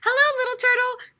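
high, loud sing-song call; calling kids. A narrator's reading. Kids on the bus greeting turtle, high loud call. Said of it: "called the kids on the school bus".

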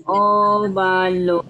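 óvalo said slowly, syllable by syllable; slow dictation. Shown as a demonstration. Teacher dictating the word to a pupil, slow. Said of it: "dictating to pupil".

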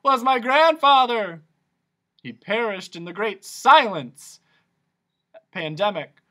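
hammed-up grief; anguished Din. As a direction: mourn it dramatically. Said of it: "cried the anguished Din".

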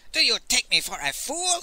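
high-pitched indignant question; snapped, affronted. A narrator's reading. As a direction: snap back as if insulted. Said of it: "he snapped".

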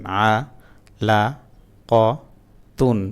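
syllables split apart with pauses, then joined; broken syllable reading. Shown as a demonstration. Teacher showing learners broken-up syllable reading, slow and separated. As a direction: read it piece by piece, then blend the word. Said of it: "ভাঙা ভাঙা উচ্চারণটা পড়বেন".

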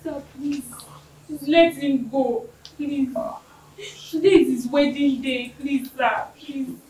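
tearful pleading. Acted. Bride begging the officer.

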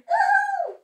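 very high, loud long "ooh"; frightened scream. A narrator's reading. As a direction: scream as the sled runs away. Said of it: "screamed Toad".

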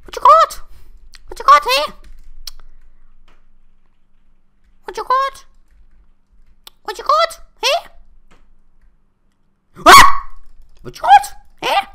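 very high, loud squeaky mob voice; squeaky creature voice. Pretend. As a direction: squeak it out like a tiny critter.

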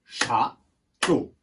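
"Château" in two far-apart beats; syllable by syllable. Shown as a demonstration. Teacher confirming two syllables, syllable by syllable. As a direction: separate Châ and teau with a long gap in "Château". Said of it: "Oui, deux".

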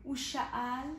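lively storybook reading with big swings; reading to children. Acted. Teacher reads a picture book to small children, lively and expressive. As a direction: read it out like a kindergarten story.

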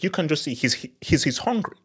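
ordinary talk, nothing marked

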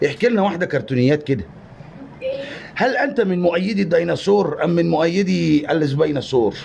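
low, earnest documentary host; mock formal interviewer. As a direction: quiz him like a TV presenter.

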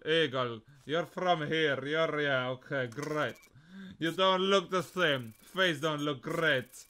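put-on Russian accent. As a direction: play a border official with a Russian accent. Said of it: "where's my Russian accent?".

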